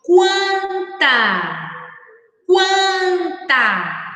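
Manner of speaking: "Quanta" stretched out, showing the U sound in kwan; model word. Shown as a demonstration. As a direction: call out "Quanta" so the kw is heard. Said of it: "leia em voz alta esta outra palavra".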